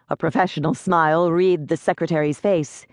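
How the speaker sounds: ordinary delivery, nothing marked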